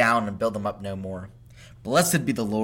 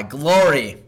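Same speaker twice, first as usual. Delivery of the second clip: louder, higher cry of glory; exclaimed cry; 4 semitones higher, 9 dB louder than usual. Acted. Reader voicing the temple crowd, a loud cry. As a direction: ring out the word like a crowd.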